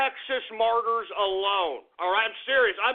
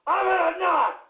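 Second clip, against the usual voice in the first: high, loud, exploding "no more"; furious shout; 8 semitones higher, 3 dB louder than usual. Acted. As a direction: slam the door on the chat, yelling. Cuts the shout-outs in mock fury.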